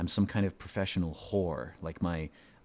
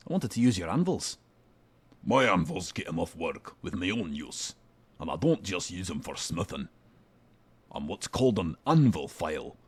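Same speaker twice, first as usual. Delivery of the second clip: put-on deeper, gruff British blacksmith accent; gruff Scottish smith. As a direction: growl it out like a crude dwarf smith. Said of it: "he's gonna have to have a deeper voice".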